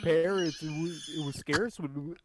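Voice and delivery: high, loud rapper voice impression. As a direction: rap it like E-40. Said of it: "a fucking E forty impression".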